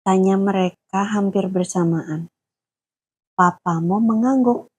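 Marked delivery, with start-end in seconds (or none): none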